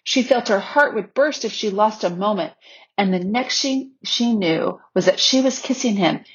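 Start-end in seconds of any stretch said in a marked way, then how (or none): none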